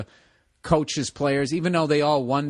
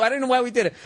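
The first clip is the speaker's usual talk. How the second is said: ordinary talk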